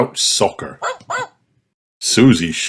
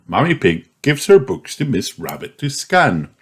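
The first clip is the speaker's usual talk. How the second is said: usual speaking voice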